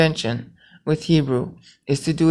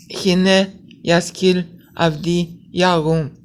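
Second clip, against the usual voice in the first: slow Hebrew recitation; 2 semitones higher, slower than usual. Shown as a demonstration. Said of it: "so you count those syllables".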